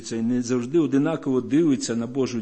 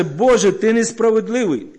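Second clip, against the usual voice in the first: mock accusation; 6 semitones higher, 5 dB louder than usual. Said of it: "Він каже".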